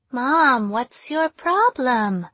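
slow, airy, lisping Wonka voice; creepy airy Wonka voice with a lisp; Johnny Depp Wonka voice. A narrator's reading. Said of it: "talking and acting like Depp's version of Wonka".

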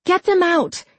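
high-pitched, urgent shout at moderate volume. A narrator's reading. Jessica pushing away to face the fox, urgent shout. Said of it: "Jessica shouted again".

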